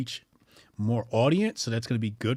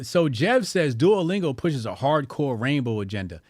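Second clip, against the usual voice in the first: ordinary talk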